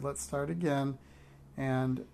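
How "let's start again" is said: ordinary delivery, nothing marked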